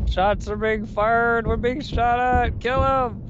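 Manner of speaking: high, drawn-out call to arms; mock battle alarm. Player warns crew they are under fire, slow high mock alarm. Player calls out being shot at.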